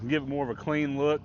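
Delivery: normal talking voice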